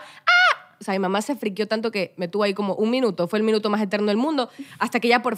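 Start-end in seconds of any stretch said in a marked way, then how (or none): 0.0-0.8 very high mock shriek; short startled squeal; imitated mum's scream. Mum realising what she did, very high mock scream. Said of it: "y mi mamá".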